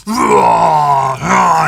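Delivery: loud rage roar; beast roar. Retold or imitated. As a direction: bellow like an enraged monster. Beast screaming in comic.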